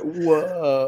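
drawn-out surfer-dude whoa; laid-back Californian amazement; Keanu. Acted. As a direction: lightly mimic a spaced-out dude. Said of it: "Keanu all the time".

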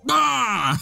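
high, loud "Ah!" to startle someone; jump-scare yell in fun; sudden short mock-scary scream; playful scare shout. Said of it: "We're gonna scare them".

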